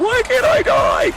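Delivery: very high, loud, drawn-out cry; retold desperate wail. Retelling her despair at surviving, anguished wail. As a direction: wail it slowly at full pitch. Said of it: "I was literally like".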